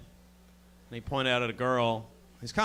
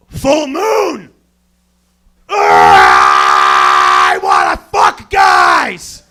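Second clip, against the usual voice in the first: howling werewolf shout, low and loud; 7 semitones lower, 20 dB louder, much slower than usual. Pretend. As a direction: transform and howl it out. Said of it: "This is my impression of a werewolf".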